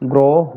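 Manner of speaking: গ্রহ with first vowel as a rounded o, "groho". Shown as a demonstration. Teacher showing the rule for students, clear o-sound in গ্রহ. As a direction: say গ্রহ as "GRO-ho", o not open ɔ. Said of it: "এই অটার উচ্চারণ ও কারের মতোই হয়".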